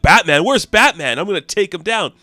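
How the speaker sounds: high, loud comic panic; acted-out frantic alarm; freaking out. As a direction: freak out and bluster at once. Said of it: "Hephaestus starts to freak out".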